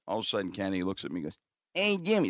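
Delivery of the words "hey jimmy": high-pitched buddy imitation; high, put-on voice of a drinking buddy. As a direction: do your pal's voice, pitched up high. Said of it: "That's how [name] talks".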